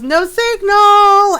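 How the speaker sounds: high, loud, drawn-out yell; mock error announcement. Streamer acting out what the screen showed, mock announcing yell.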